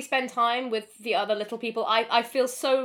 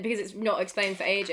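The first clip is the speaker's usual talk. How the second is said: normal talking voice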